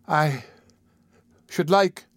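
halting and timid, word by word; faltering. Acted. The child tries again before Mister Bumble, faltering. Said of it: "faltered the child".

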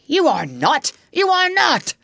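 denial building to a high, loud cry; hoarse cry. A narrator's reading. Hook denies the impostor's claim, crying out. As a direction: repeat it with mounting desperation. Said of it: "Hook cried hoarsely".

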